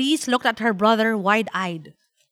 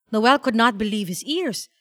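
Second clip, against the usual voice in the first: ordinary delivery, nothing marked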